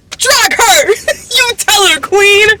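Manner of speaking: high-pitched hype with a laugh; laughing, high, egging her on; gleeful cheering. Real, not acted. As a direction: cheer her on like a delighted fan. Cheering on the daughter's insult.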